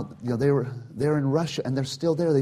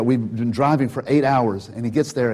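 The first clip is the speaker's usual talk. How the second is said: normal talking voice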